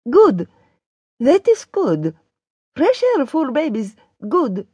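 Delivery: high character voice, approving; high-voiced Daria on the phone; relieved Daria. A narrator's reading.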